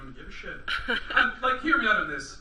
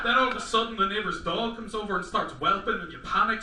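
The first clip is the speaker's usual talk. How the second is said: ordinary talk, nothing marked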